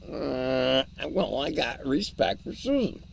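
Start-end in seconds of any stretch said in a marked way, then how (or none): none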